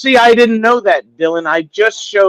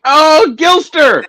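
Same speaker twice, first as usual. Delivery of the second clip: much higher, much louder shout of the name; 11 semitones higher, 9 dB louder, much slower than usual. Real, not acted. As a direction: call the name out to the chat. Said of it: "Say it loud".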